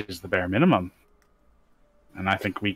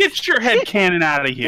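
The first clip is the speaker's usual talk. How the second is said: much higher, louder mock order; mock shout; 11 semitones higher, 6 dB louder than usual. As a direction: shoo the theory away in jest. Joking dismissal.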